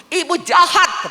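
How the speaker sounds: high, slow begging for forgiveness; pleading apology. Retold or imitated. Pastor begging the hurt worker to forgive her, high and pleading. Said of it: "Saya bilang".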